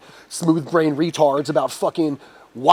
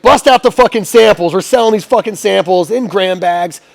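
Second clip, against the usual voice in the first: loud, raised-pitch command, retold; barked order; 4 semitones higher, 5 dB louder than usual. Dispensary owner telling staff to sell samples, barked order.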